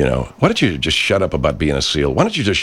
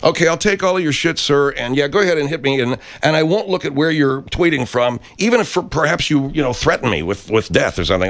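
higher, simpering mock-polite voice; sarcastic mock meekness; 8 semitones higher than usual. Mocking what the troll expects of him: higher simpering sarcasm. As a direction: play the doormat, dripping sarcasm.

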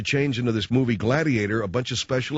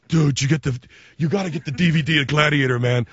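insistent buddy pushing a DVD; lightly voiced movie fan. Movie fan urging a friend to get the Gladiator DVD, mildly insistent. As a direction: press it on a pal, slightly raised.

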